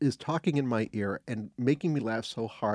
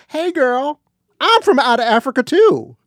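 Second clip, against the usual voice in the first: put-on high, loud sassy "hey girl" voice; sassy girlfriend greeting; 11 semitones higher, 12 dB louder than usual. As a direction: squeal it like a brassy best friend. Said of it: "sit down next to Meryl Streep and go".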